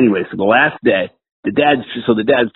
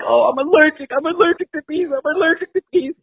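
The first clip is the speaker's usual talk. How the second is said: very high, hammed-up panicked squeal; hyperventilating panic. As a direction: mimic a man freaking out in falsetto.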